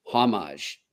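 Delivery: "Homage" said as HAH-mahzh; "Homage" with a French-style soft zh ending, first vowel ah; corrected pronunciation. Shown as a demonstration. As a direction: say "Homage" with an open "ah" in the first syllable. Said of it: "I've been pronouncing it wrong".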